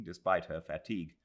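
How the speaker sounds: ordinary delivery, nothing marked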